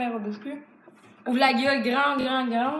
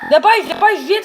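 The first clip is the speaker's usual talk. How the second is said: loud, raised-pitch urging; urgent hurrying; 5 semitones higher, 9 dB louder than usual. Real, not acted. Player rushing friend before time runs out, urgent shout. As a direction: call out to rush them.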